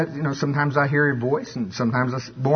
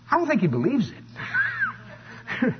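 doubting listener; 11 semitones higher, 4 dB quieter, much slower than usual. Acted. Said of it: "And they go".

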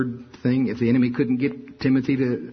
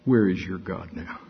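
lower, sneering devil's whisper in the ear; devil taunting; 6 semitones lower than usual. Retold or imitated. Devil sneering at the beaten apostle, voiced lower. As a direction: taunt him like the devil, low. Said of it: "what would the devil be saying in your ear".

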